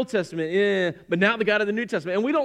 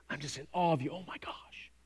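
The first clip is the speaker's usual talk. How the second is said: hushed and breathless, almost no voice; awed whisper; 5 semitones lower, 12 dB quieter than usual. Retold or imitated. Preacher acting a disciple's awestruck hush to the storm. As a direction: breathe it out in wonder. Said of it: "cowardice and awe".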